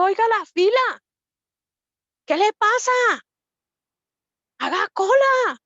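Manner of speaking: high, loud mock shout at a cutter; angry scolding. Woman imagining scolding a line-cutter, high loud shout. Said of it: "yo sí le hubiera dicho".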